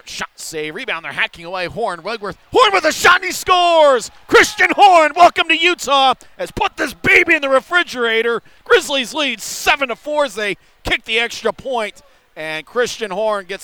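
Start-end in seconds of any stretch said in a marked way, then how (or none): 2.5-11.1 high, loud goal call; goal call shout. Real, not acted. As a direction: belt out the goal. Goal call in hockey broadcast.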